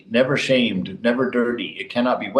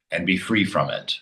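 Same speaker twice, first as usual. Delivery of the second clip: ordinary talk, nothing marked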